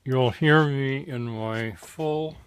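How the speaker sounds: talking around tacks in the mouth. Real, not acted. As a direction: mumble through a mouthful of tacks. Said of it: "It's not easy for me to talk because I have tacks in my mouth".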